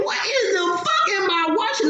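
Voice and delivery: high and fast squeal of disbelief; shocked disbelief. Retold or imitated. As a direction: react like you can't believe your eyes. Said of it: "I'm like".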